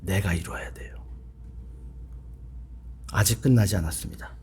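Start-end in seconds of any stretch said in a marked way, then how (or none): none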